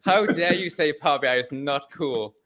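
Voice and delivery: mock outrage. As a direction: ham up the betrayed dad.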